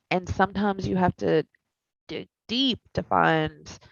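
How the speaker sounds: ordinary talk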